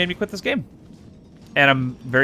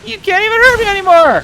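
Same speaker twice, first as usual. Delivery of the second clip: high, loud triumphant yell; gloating shout; 12 dB louder than usual. Real, not acted. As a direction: crow over the beaten enemy. Gloating during fight.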